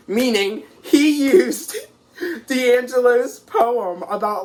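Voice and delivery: high, loud, breaking up with laughter; real laughter bursting through, words stretched out; laughing. Real, not acted. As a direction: laugh helplessly through the reveal. Cracking up at borrowed poem.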